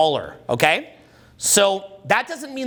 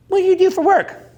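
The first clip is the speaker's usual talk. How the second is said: much higher, louder mock-girly voice; put-on woman's question; 12 semitones higher, 5 dB louder than usual. Shown as a demonstration. Acting a woman asking a man his job, high-pitched curiosity.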